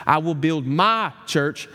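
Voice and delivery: slow line peaking on "my"; stress on my. Retold or imitated. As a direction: lean hard on the word "my". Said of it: "emphasize a different word of that promise".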